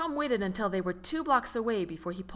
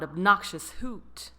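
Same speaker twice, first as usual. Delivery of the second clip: usual speaking voice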